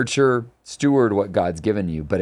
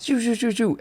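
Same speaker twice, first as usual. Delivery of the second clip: whooshing sound. Retold or imitated. Imitating a celebrity rushing past.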